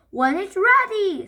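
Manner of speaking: high, loud exasperated scream. Acted. Dad screaming back at Henry. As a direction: yell back in frustration. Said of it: "screamed Dad".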